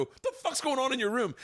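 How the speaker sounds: high-pitched, incredulous demand; shocked outburst. Retold or imitated. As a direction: replay shock at walking in on chaos. Acting shocked shout at Elvis.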